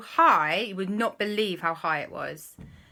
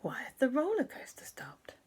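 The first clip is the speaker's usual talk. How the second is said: soft, higher whispered question; echoed whisper; 6 semitones higher, 7 dB quieter than usual. Acted. Other passengers echo the question, higher hushed whisper. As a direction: whisper it as a puzzled crowd.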